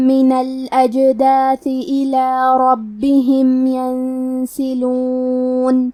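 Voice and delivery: slow, drawn-out tajweed recitation; Quran recitation. Shown as a demonstration. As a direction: chant each word slowly and melodically. Teacher recites Quran verses. (آہستہ، ترتیل کے ساتھ تلاوت)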